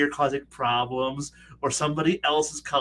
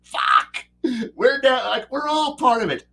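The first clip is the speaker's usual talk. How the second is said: high, loud disbelief with a laugh; shocked players; 6 semitones higher, 5 dB louder, slower than usual. Retold or imitated. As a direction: react as if the draft papers just hit your face. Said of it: "thrown in their face and go".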